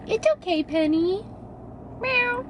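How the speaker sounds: high cooing plus a meow; high pet talk. Real, not acted. Owner calming Penny on the drive, high coo. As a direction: soothe the kitty in a squeaky voice. Comforting the cat.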